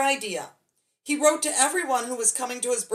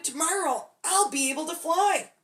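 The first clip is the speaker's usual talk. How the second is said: excited; 6 semitones higher than usual. A narrator's reading. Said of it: "he shouted".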